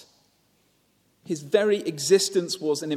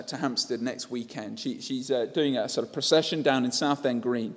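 ordinary talk, nothing marked

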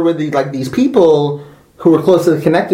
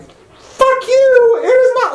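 much higher, drawn-out fuck you with a laugh; angry outburst; much slower than usual. Real, not acted. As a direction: fling it out, furious and half laughing. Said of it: "It's like, pissing me off so hard".

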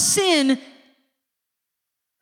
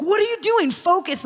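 higher-pitched retold scolding; lightly acted impatient coach; exasperated coach; 6 semitones higher than usual. Coach pulls player aside during warm-ups, exasperated.

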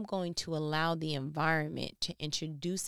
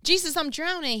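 high, loud cry for help; hammed-up drowning plea; mock panic; 10 semitones higher, 9 dB louder than usual. Preacher as Peter, calling to Jesus in a loud high mock panic.

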